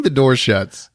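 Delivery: ordinary talk, nothing marked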